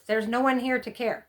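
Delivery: ordinary delivery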